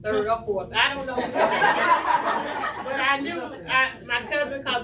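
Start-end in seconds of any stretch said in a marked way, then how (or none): 1.2-2.8 loud burst of laughter; real big laugh. Real, not acted. Laughs after joke about worst day.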